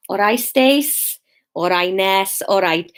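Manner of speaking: practised Welsh lilt; Welsh accent. Shown as a demonstration. Narrator warming up to get into Welsh, Welsh accent. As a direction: slip into a Welsh accent, stretching the vowels. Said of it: "how do I get into Welsh".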